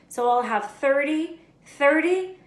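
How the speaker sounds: put-on high, loud child counting it out; slow counting boy. A narrator's reading. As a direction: work the sum out slowly. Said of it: "he said slowly".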